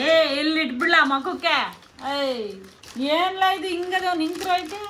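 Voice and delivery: loud, high-pitched telling-off; real scolding shout. Real, not acted. Mother scolding her son over the box, loud and angry. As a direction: yell at him, high and forceful.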